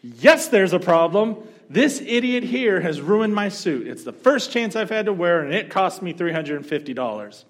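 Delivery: loud, high shouting complaint; furious shout. Retold or imitated. Angry diner shouting at the manager about his suit. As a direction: blast the manager with outrage.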